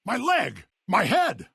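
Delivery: high-pitched cries of pain; wounded outcry. Acted. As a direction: cry out as each blow lands. Crying out when wounded.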